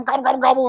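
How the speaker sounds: a light, hammed-up gobble, gobble; mock turkey gobble. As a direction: toss off a quick turkey call mid-story. Imitating wild turkeys on mountain.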